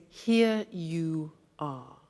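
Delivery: "Here you are" said slowly, each word separate; slow careful. Shown as a demonstration. Teacher showing the slow careful form of the phrase. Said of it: "that becomes".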